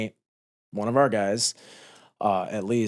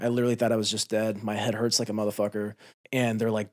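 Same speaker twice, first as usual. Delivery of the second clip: ordinary delivery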